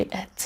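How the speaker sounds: ات as a reduced "ut"; ات, schwa then t; schwa plus t. Shown as a demonstration. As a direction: say ات softly with a neutral vowel and a t. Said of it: "یه شوا تلفظ می کنیم و یه دونه ت".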